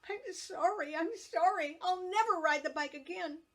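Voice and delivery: high, loud guilty cry; screamed apology. A narrator's reading. Guilty girl screaming sorry to her mother, high and loud. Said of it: "she screamed".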